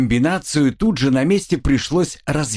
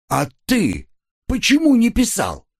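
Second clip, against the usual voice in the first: high, indignant counter-question; accusing question; 11 semitones higher than usual. A narrator's reading. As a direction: throw the question back, voice leaping up. Brother turns the question back accusingly. (возмущённый встречный вопрос на высокой ноте)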